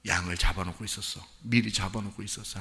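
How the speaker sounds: normal talking voice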